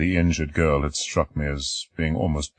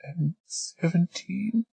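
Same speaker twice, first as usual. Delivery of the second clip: put-on shy girl; 14 semitones higher, 5 dB quieter, much slower than usual.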